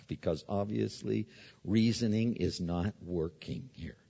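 ordinary delivery

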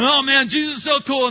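loud, high, gushing enthusiasm; excited gushing. Retold or imitated. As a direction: gush like a fired-up new believer. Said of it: "they're going".

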